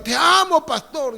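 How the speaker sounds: high, sweet fake "te amo"; lightly voiced hypocrite; mock flatterer. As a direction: imitate an insincere member gushing. Mocking empty words of love.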